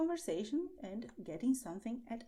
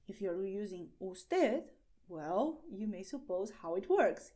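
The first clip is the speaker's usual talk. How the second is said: normal talking voice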